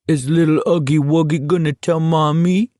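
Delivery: put-on a jeering high baby-talk voice; mocking baby talk. A narrator's reading. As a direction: tease him like a baby. Kintz taunting in baby talk.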